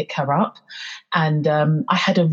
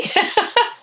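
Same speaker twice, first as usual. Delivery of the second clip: high, nervous laugh. Real, not acted. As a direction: laugh off the emotion. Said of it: "That's a nervous laugh".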